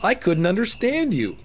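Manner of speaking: put-on a boy who missed the words; lightly voiced puzzled boy. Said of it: "said Jonathan".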